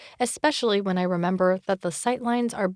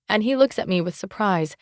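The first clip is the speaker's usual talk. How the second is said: plain reading voice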